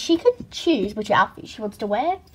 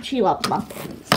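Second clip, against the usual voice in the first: ordinary talk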